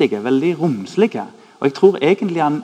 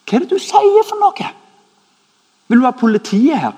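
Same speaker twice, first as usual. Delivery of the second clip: outraged; 10 semitones higher, 7 dB louder than usual. Retold or imitated. Outraged reply to the friend.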